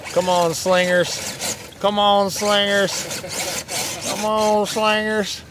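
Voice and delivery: high, drawn-out urging of the truck; excited cheering. Real, not acted. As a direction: cheer it on up the hill, pitched high.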